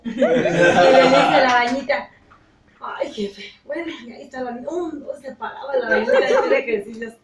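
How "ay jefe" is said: whispered tiny voice; hushed sigh to the boss; spider's reply. Retold or imitated. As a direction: whisper like a timid little spider.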